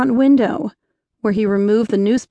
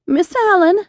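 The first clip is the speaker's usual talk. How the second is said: put-on hoarse, higher young woman's voice; 6 semitones higher, faster than usual. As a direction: catch in a dry throat. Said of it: "she began hoarsely".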